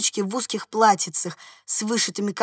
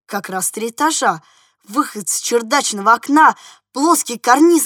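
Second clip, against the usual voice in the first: usual speaking voice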